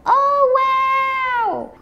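high, long oh wow; amazed. Real, not acted. Watching the toy dissolve, a high amazed wow.

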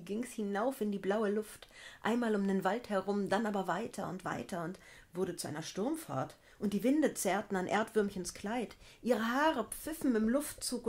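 usual speaking voice

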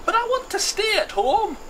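very high, loud wail; high-pitched moaning character; whining protest. Acted. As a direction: whine like a reluctant traveller. Hamish protests the trip.

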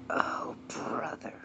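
exasperated whisper under the breath; hushed, fed-up whisper; whispered aside. Acted. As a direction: mutter it unseen with a sigh.

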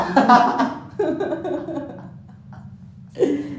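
long loud laughter. Real, not acted. Laughing at joke. (tertawa panjang)

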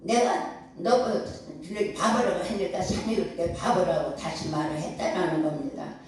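usual speaking voice

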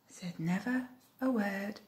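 very soft hushed ending. A narrator's reading. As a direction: say it almost silently. Refrain, ladybird silent.

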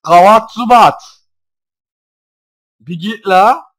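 loud, high, slow cursing at the bat; exasperated shout. Real, not acted. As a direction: spit out the curse in frustration. Cursing Zubat at loud volume. (bıkkın öfkeli bağırış)

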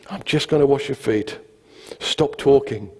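calmed-down, understated firmness; dry, weary restatement; deadpan. Retold or imitated. Calmed second take, deadpan.

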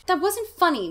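acted cross yell; angry scream. A narrator's reading. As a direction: shriek it in outrage, held in check. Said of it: "Margaret screamed".

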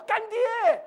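high, loud drawn-out call; calling out to godfather. Retold or imitated. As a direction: act out hailing a long-sought godfather. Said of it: "他就叫干爹". (模仿高声拉长地喊干爹)

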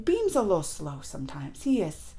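unvoiced conspiratorial whisper; breathy whispered aside. Real, not acted. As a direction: whisper it like a secret. Aside about Beam being slow.

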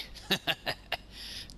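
high, breathy giggle; mocking snicker. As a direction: giggle at outwitting the police. Mocks the police.

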